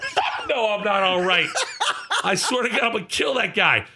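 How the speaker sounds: high-pitched, laughing mock rage; retold fury. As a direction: protest indignantly through laughter. Re-enacting rage to concerned crew.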